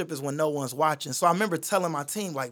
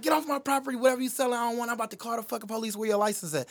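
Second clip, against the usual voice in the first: high-pitched mock angry lady; imitating a furious homeowner yelling at salesmen; angry lady cussing; 7 semitones higher, faster than usual. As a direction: cuss them out in a high, fast woman's voice. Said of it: "this lady come out cussing us out".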